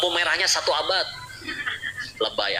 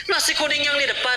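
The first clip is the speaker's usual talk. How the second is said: mock surprise; 11 semitones higher than usual. Wife voiced in dialogue.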